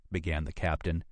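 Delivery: ordinary delivery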